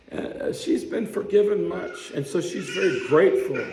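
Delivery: mild indignant teaching; lightly voiced Jesus. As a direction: press the contrast home to Simon. Jesus continues the rebuke.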